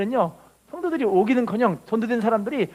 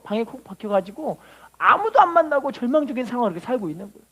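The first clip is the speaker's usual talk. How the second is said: ordinary talk, nothing marked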